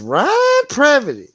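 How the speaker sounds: very high, loud and slow drawn-out name; M T R's way. Retold or imitated. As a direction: stretch the name out in a squeaky voice. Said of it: "Love the way M T R says".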